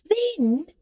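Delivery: high, sharp little woman; Jenny's sharp voice. A narrator's reading. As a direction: scold from up high.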